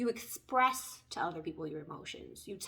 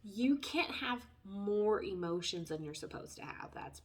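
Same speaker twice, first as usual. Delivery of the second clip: ordinary talk, nothing marked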